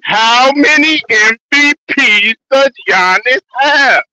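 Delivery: very slow, every word drawn out; slow, emphatic repeat. Real, not acted. Repeating the MVP question after a "Huh?", very slowly and deliberately. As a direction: spell the question out word by word, stretching each.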